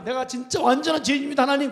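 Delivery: loud, pleading prayer from the pulpit; impassioned confession. Acted. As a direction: cry out to God as a penitent sinner. Acts out a mourner's confession to God.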